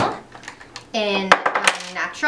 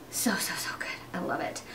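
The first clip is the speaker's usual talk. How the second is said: soft delighted whisper; 3 semitones lower, 13 dB quieter than usual. Real, not acted. Telling viewers how good the gym feels, whispered. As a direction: breathe it like a secret. Gushing about working out.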